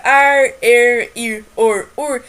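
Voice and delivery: "Ar, er, ir, or, ur" chanted apart, each R held; syllable-final R. Shown as a demonstration. Teacher recalling the syllable family "Ar, er, ir, or, ur", drawn-out demonstration. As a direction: sound out "Ar, er, ir, or, ur" one by one, stretched. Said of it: "tem um outro som".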